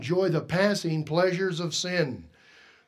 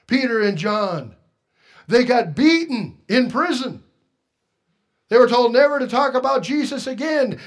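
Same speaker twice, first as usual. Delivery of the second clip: loud, high preaching; rising pulpit cry; 6 semitones higher, 7 dB louder than usual. Real, not acted. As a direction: preach it out with fire. Preaching at full force.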